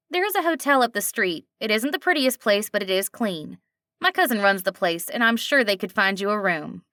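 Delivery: a friendly diner waitress with a slight twang; a hint of a nasal twang. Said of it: "slight twang to it".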